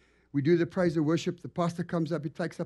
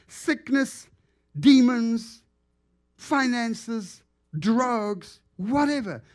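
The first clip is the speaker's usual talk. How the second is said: higher, louder preacher's list; fired-up pulpit delivery; preaching at full force; 7 semitones higher, 6 dB louder, much slower than usual. Real, not acted. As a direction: drive each word home to the congregation.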